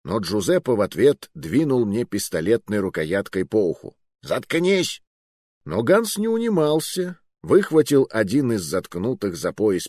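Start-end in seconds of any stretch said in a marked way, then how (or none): 4.2-5.0 high-pitched curt silencing; irritated rebuke. A narrator's reading. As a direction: shut the hostage up at once. Captor silences hostage after hitting him. (раздражённо, резко)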